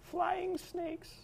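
put-on high, soft old woman; a frail granny, up high; old lady voice. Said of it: "old lady voice".

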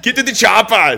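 mock movie catchphrase; action star impression. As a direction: bark it like a movie commando. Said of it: "I can't even think of what he sounds like".

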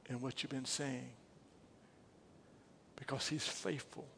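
preacher gently closing; soft closing. Real, not acted. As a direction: bring it down to a hush.

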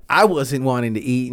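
ordinary talk, nothing marked

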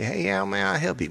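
high, friendly Southern greeting; Southern accent. Acted. Phone agent from down south greeting a caller, Southern accent. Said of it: "Get down south, you know, and say".

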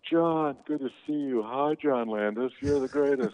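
high-pitched, sing-out fawning hello; gushing greeting. Retold or imitated. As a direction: imitate eager actors buttering up a director. Everyone fawning over John Landis.

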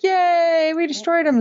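high, triumphant mock cheer; high-pitched pretend victory cry. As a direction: imitate rebels celebrating too soon. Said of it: "Why are you guys cheering?".

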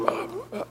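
faint voiceless dying grunts; struggling to talk. Retold or imitated. Tortured prisoner trying to talk, faint grunts. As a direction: imitate weak attempts at speech, barely audible. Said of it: "he's trying to talk".

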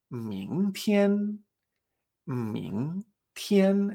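slow "mingtian", rising then held high; tones two-one. Shown as a demonstration. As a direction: stretch "mingtian" for learners to copy. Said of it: "la combinación dos uno".

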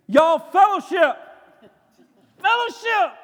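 high, loud shouted command; scream. Acted. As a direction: yell it at a whole crowd. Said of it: "get up here and scream".

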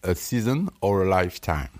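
English said with a heavy French accent. Shown as a demonstration. Host reading an English proverb, in French-accented English. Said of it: "la puissance de mon accent".

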